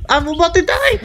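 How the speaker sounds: high panicked yell; panicked cry. Real, not acted. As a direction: scream it out as you're dying. Dying in game, crying out.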